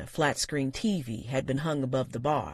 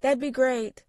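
over the shoulder; 11 semitones higher, 5 dB louder than usual. A narrator's reading. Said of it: "she said over her shoulder".

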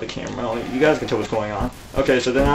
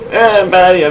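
terrified horror victim; 7 semitones higher, 8 dB louder than usual. Real, not acted. Panic, repeated.

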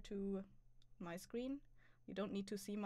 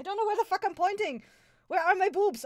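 excited; 9 semitones higher, 11 dB louder than usual. Real, not acted. Laughing at her own pointing.

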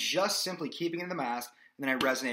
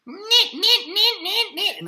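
mask resonance; 11 dB louder than usual. Shown as a demonstration. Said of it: "That comes from this".

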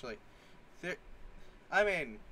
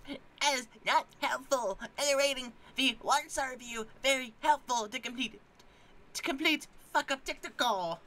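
Karen voice; 7 semitones higher than usual. Acted. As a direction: complain like an entitled Karen. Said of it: "the Karenist voice I know".

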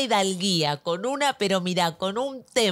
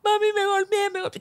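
high, wailing mock child voice; high-pitched whimpering child impression; crying little child; 11 semitones higher than usual. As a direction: imitate a hurt kid bawling to mum. Voices hurt child wailing to mother.

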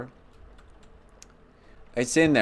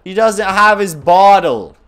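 higher, louder, slow wail; loud distress; 7 semitones higher, 12 dB louder than usual. Real, not acted. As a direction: cry it out. Upset about hungry baby.

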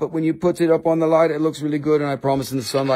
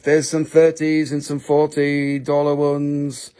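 ordinary delivery

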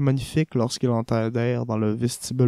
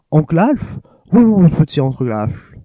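louder, higher, lightly voiced young woman; girl calling out; 4 semitones higher, 7 dB louder than usual. Niece greeting her uncle loudly, lightly voiced. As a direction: call him sweetly across the room.

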